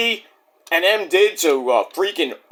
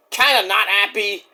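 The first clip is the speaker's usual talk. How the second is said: normal talking voice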